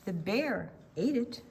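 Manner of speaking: ordinary talk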